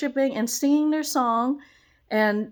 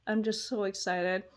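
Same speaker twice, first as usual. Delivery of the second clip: ordinary delivery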